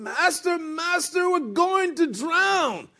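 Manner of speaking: high-pitched acted plea, last words stretched out; panicked cry for help. A narrator's reading. Disciples waking their master as the boat swamps, panicked cry. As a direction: call out urgently, voice pushed high.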